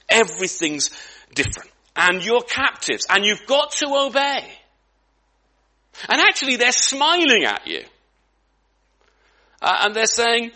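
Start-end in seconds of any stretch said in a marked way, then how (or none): none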